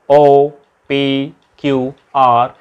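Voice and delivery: usual speaking voice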